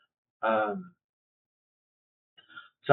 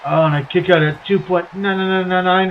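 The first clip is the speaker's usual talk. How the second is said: play-by-play; 3 semitones higher than usual. Acted. Play-by-play wrestling call.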